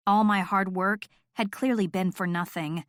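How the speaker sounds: ordinary delivery, nothing marked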